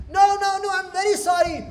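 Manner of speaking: high, loud, hurried begging; acted childlike pleading, pitched right up; pleading apology. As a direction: beg your friends to take you back. Krishna apologizing to playmates.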